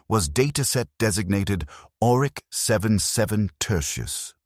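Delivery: ordinary delivery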